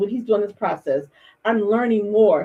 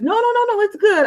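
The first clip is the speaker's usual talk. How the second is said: high, eager reassurance; excited friend insisting it's good; excited producer; 13 semitones higher, 4 dB louder than usual. Retold or imitated. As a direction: gush it out much higher like great news. Producer voiced, excited good news.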